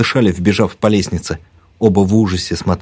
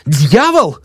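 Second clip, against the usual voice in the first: raised, higher-pitched angry cry; yelling at her; 8 semitones higher, 4 dB louder than usual. A narrator's reading. Narrator yanks the old woman close, yelling. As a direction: throw the word at her. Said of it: "заорал на неё".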